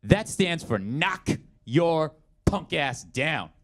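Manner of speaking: slow, drawn-out movie-cop swagger; mock badass cop, very slow; tough cop line. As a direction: lay it down like a hammy action hero. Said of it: "he goes".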